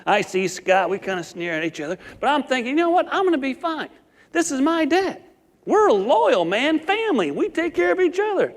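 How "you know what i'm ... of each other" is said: higher, pumped-up self-talk; boy assuring himself his dad will protect him; cocky reassurance. Retold or imitated. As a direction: psych yourself up about family loyalty. Confident inner thought, trusting dad.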